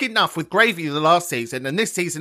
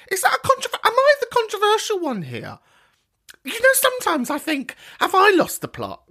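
high, camp mock self-doubt; high camp doubt over a Christmas dinner row; 13 semitones higher than usual. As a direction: wonder aloud in a high camp voice.

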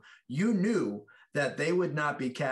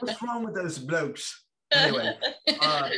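put-on playful stab at an English accent; mock English accent; 9 semitones higher, 4 dB louder than usual. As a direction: lightly put on a British voice. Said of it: "do an English accent".

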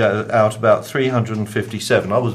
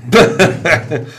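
brief, higher laugh; amused laugh; 6 semitones higher, 5 dB louder than usual. Real, not acted. Presenter reacting to a Christmas quip, amused laugh.

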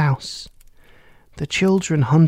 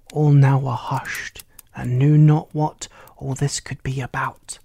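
usual speaking voice

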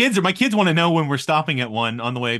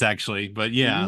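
usual speaking voice

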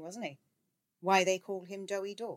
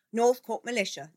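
higher, louder rallying cry; 7 semitones higher, 10 dB louder than usual. A narrator's reading. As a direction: cry it out like a war memory. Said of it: "the editor cried".

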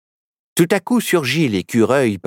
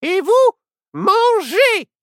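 very high witch voice; high-pitched, bossy witch; commanding witch; 4 dB louder, slower than usual. Acted. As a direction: order the prisoners as a storybook witch. Witch orders captives to eat.

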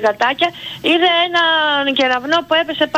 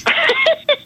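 high-pitched burst of laughter; sudden high laugh. Real, not acted. Caller laughs at host's remark.

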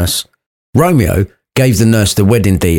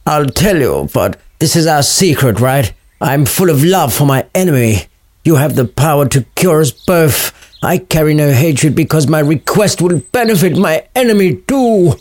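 Romeo pleading in a raised, theatrical voice; overwrought lovestruck speech; 7 semitones higher than usual. Acted. Romeo confides his grand plea to the friar, overwrought. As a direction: lay it on thick like a love-drunk poet. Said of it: "Just speak normally, boy".

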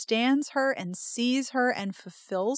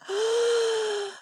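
swooning gasp; overwhelmed high intake; long high gasp; 12 semitones higher than usual. Real, not acted. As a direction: draw in a high, drawn-out gasp. Reaction to the romantic passage.

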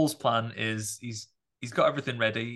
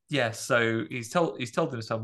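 ordinary talk, nothing marked

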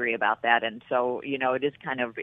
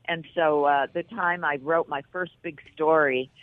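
usual speaking voice

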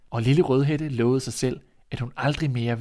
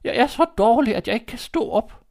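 put-on high, weak old-woman voice; a sick granny explaining from bed; frail grandmother; 11 semitones higher, 6 dB louder than usual. Grandmother to the visitor, high and frail.

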